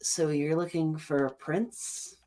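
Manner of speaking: slow, high goblin voice. Acted. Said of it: "one of them goes".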